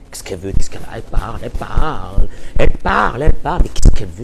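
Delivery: exasperated husband. Retold or imitated. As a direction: vent like a husband at the end of his rope. Husband ranting about nagging wife.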